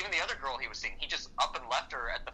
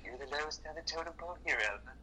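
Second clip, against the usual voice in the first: sneering put-down, softer, then jumping higher; mock condescending boss; 3 semitones higher, 5 dB quieter than usual. Mimicking the boss belittling his secretary Alba, sneering. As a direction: belittle her like a smug boss. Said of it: "It's like".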